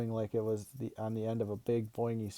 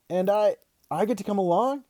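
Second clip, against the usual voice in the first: high, loud, halting question; excited disbelief; 10 semitones higher, 6 dB louder than usual. Acted. Kid asking dad about the interview, excited and incredulous. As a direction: stumble into it, rising with hope.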